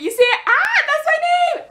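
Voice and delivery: high-pitched thrilled outburst; excited squeal. Real, not acted. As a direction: squeal with excitement at spotting your name. Excitedly shows name on shirt.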